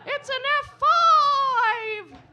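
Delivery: very high, loud, slow squeaky yell; slow, high cartoon-voice shout; Mickey Mouse shout. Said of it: "sounds like Mickey Mouse".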